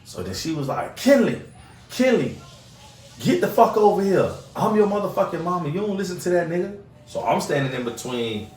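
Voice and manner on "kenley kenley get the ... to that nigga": higher, mock yelling mother; mother summoning her son away from him; imitating Trina yelling. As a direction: call the kid over like a furious mom.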